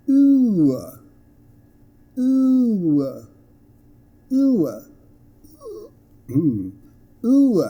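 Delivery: high, loud "ooh" falling into uh; diphthong demo. Shown as a demonstration. Teacher models the next sound, "ooh" high and gliding. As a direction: stretch "ooh" into oo-uh. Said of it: "And then".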